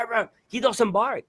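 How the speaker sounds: ordinary talk, nothing marked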